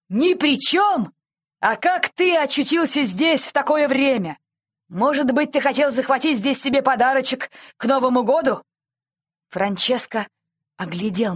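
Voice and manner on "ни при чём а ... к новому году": loud, raised, mocking questioning; sarcastic interrogation. A narrator's reading. Policeman grilling the boy found in the shop, sarcastically. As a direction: pounce on the excuse with scornful disbelief.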